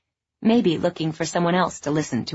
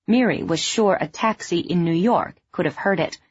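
ordinary delivery, nothing marked

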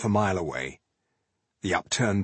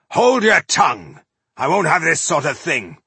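high, loud stern order; calling out; 7 semitones higher, 6 dB louder than usual. A narrator's reading. Dwarf scolding an unseen troublemaker, calling out sternly. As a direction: rebuke the culprit at raised volume. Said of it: "he called out".

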